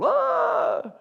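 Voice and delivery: high, very slow bellowing guardian; a fierce deity booming at the trampled man; hammed-up guardian. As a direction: block the way with mock grandeur. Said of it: "a guy who's going".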